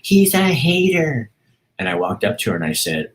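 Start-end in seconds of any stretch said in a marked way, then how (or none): 0.0-1.3 very high-pitched mock heckler yell; imitated screaming. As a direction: screech it out like an angry protester, drawn out at the end. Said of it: "at the top of her lungs".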